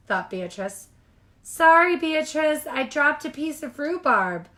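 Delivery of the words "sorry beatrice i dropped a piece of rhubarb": put-on higher, louder girl's apology; a little friend saying sorry brightly; voiced young friend apologizing; Millie voice. A narrator's reading.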